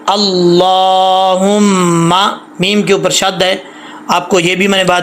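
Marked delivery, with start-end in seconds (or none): 0.1-2.4 अल्लाहुम्मा drawn out slowly with a long nasal hum on the doubled मीम; slow recitation. Shown as a demonstration. Teacher showing the right reading, slow and drawn out. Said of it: "तो कैसे पढ़ेंगे?".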